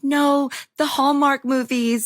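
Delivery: high, slow, gushing fan voice; the girl who only loves the Hallmark movies; adoring fan. Retold or imitated. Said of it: "And she was like".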